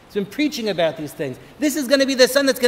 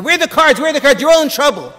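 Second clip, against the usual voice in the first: loud, high, barking guard demanding answers; guard shouting; 6 semitones higher, 5 dB louder, faster than usual. Retold or imitated. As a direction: storm in and shout at the prisoners. Guard bursts in on prisoners.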